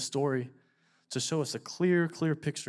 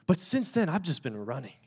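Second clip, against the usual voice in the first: loud, raised preaching voice; preacher giving an invitation to the congregation; fervent altar call; 4 semitones higher, 6 dB louder than usual. Acted. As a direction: urge them to respond now. Altar call at full force.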